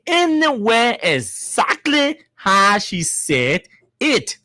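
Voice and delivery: very slow, word by word; slow emphatic repeat. Real, not acted. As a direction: spell out the instruction, stretching each phrase.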